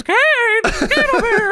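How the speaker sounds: very high falsetto yell; Paul Bearer. Acted. Wrestling manager summons Kane from the stairs, high squeal. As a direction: squeal the order as a comic manager. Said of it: "Paul Bearer says".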